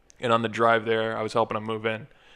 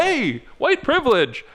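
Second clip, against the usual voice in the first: mock triumphant; 4 dB louder, slower than usual. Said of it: "And we're like".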